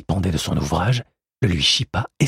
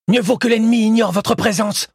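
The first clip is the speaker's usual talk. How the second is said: much higher, loud and pressing; urgent warning; 5 dB louder than usual. Acted. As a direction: keep them hidden, hurry them. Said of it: "lança-t-il à sa sœur". (avertissement pressant et fort)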